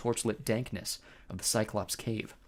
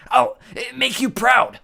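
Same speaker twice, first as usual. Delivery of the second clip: trembling voice; 13 semitones higher, 12 dB louder, slower than usual. A narrator's reading. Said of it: "her voice trembling".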